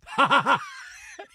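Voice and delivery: supervillain cackle; high, mock-villain cackling laugh; Barry Melrose. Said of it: "starts cackling like he's a villain".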